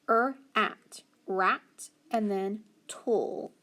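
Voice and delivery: "er, at" as split sounds, blended to "rat", then "tool" apart; decoding by parts. Shown as a demonstration. Teacher decoding rattle for pupils, "rat" and "tool" in separate parts. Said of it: "I can look and see".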